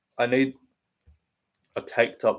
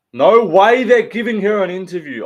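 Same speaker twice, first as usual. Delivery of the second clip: outraged shout; 10 semitones higher, 10 dB louder than usual. Real, not acted. Outraged at possible interview.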